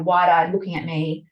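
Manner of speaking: normal talking voice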